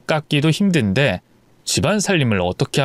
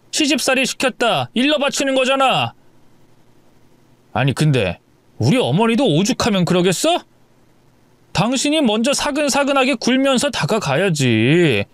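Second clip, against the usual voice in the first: higher, impatient husband lecture; annoyed husband lecturing; 7 semitones higher than usual. Acted. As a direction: talk down to her, exasperated. (아내를 훈계하는 남편의 높고 짜증 섞인 목소리)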